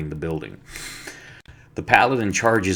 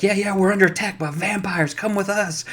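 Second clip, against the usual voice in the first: much higher, hammed-up cry that vampires are attacking; mock panicked alarm; 12 semitones higher than usual. Wife fakes a vampire alarm to the guards, hammed-up high squeal.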